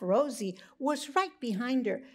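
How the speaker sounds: plain reading voice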